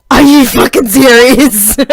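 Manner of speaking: loud, drawn-out outraged question; incredulous yell. Retold or imitated. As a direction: yell it in disbelief, re-enacting the moment. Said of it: "I'm, like, yelling at my stepdad".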